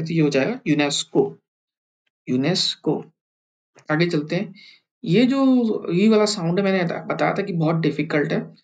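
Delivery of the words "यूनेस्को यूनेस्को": यूनेस्को quickly, the स sound reduced; hurried यूनेस्को with a light स; fast reading. Shown as a demonstration. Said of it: "स की आवाज़ें कम हो जाएगी".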